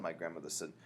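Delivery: ordinary delivery, nothing marked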